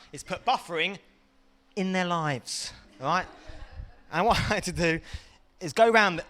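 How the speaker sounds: ordinary talk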